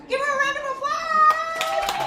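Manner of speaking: high, loud, slow call to the crowd; rally the audience for applause; announcer shout. Real, not acted. Host calls out to the crowd.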